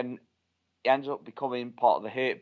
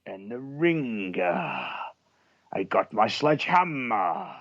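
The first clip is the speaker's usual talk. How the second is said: slow, drawn-out endings in a put-on promo voice; hammed-up wrestler promo; mock wrestler voice; slower than usual. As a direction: stretch each final syllable like a wrestling catchphrase.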